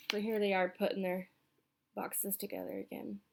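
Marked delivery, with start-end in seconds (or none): none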